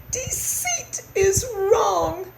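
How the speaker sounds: high, slow tearful squeak; slow mousy wail; remorseful mouse. A narrator's reading. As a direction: draw it out in a small weepy voice. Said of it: "said Christopher".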